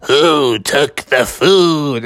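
slow, hooting owl-like call; drawn-out owl imitation; badger sounding like an owl. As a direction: stretch the words into hoots. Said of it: "you sound just like the owl family".

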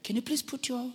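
very soft, polite request; calm cabin attendant. Retold or imitated. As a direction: imitate an attendant quietly reassuring passengers. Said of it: "they're talking calmly".

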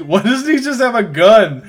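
loud, exasperated demand; angry proclamation. Retold or imitated. Co-host quoting his own reaction to a useless weapon, angrily proclaimed. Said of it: "I think I angrily proclaimed".

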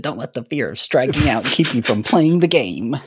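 hammed-up sports movie wisdom; mock inspirational quote. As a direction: deliver it like a corny motivational poster.

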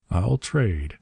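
ordinary delivery, nothing marked

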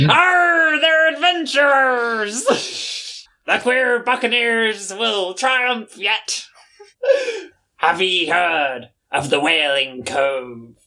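high, slow hammed pirate cheer; excited pirate shout. Pirate captain cheering the adventurers, excited shout. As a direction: roar it out in pirate glee.

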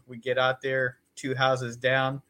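ordinary delivery

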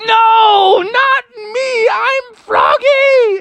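very high, loud shouting protest, slowing at the end; crying-out Froggy. Acted. Froggy under the net, very high crying shout. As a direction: yell that it's him. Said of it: "and Froggy's like".

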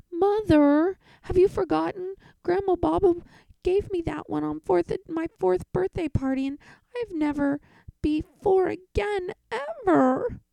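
put-on high, loud melodramatic kid wail; dramatic child. Boy theatrically protests to mother, high and wailing. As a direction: overact the outrage, rising to a cry. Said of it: "Don't be so dramatic".

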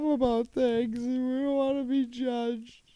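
high mock voice. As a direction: play a high whiny innocent.

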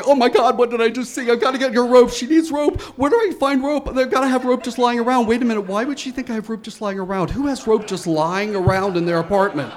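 high, loud, fast frantic babble; panicked freaking out. Retold or imitated. Neighbor running for rope, high frantic panic. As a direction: rattle it off in a total panic. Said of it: "I'm freaking out, going".

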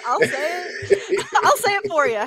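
slow, high and laughing; drawn out through laughter. Real, not acted. Offering to say it, laughing.

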